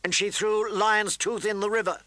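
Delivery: high, insistent accusation; aggrieved boy telling on her; Joffrey insisting. A narrator's reading. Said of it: "Prince Joffrey insisted".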